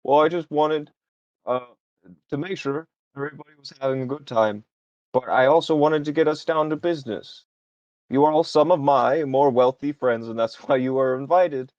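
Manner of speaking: charming toast turning to business; admiral character voice. Acted. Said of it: "and he goes".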